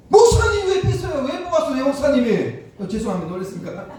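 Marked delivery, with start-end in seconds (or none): 0.1-2.6 much higher, much louder shouting at the pastor; imitated angry scream, full volume; retold furious yell. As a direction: yell it as an outraged old deacon. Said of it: "막 꽝을 지르고".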